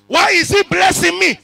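high, loud preacher question; preaching shout. Acted.

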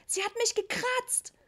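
very high, drawn-out yell; boy bellowing. A narrator's reading. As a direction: howl in outrage like a hurt child. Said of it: "brüllte Richie". (brüllender Junge, hoch und gedehnt)

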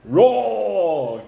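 long playful roars, each falling away; pretend lion roar. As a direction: lead the children in roaring like lions. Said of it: "let's roar like a lion".

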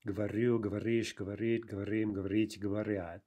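every form from Говорю to говорят stressed on its ending; chant-like list, hitting РЮ, РИШЬ, РИТ; end stress throughout. Shown as a demonstration. Teacher reciting the conjugation, Говорю to говорят stressed on endings. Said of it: "remain there throughout all the forms".

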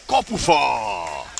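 drawn-out excited shout of the goalpost; excited call. Real, not acted. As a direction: stretch the word out in excitement. Goalpost hit in live commentary.

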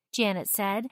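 usual speaking voice

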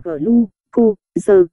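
"G, lu, cô, giờ" in four detached syllables; syllable-by-syllable reading. Shown as a demonstration. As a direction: sound out "G, lu, cô, giờ" as gờ-lu-cô-dơ. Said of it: "gluco đọc là". (đọc "G, lu, cô, giờ" tách từng âm tiết)

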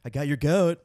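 loud, triumphant taunt; gleeful taunt. Pretend. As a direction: crow it out loud. Punchline shouted.

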